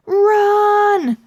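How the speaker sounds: high, loud panicked yell. Acted. Stink yells at friends to run from the blood. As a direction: shout at friends to flee. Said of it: "Stink yelled".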